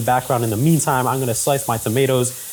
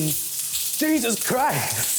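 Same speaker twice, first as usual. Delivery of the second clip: shocked; 2 semitones higher, 4 dB quieter, much slower than usual. Real, not acted. Shocked by oil in pan.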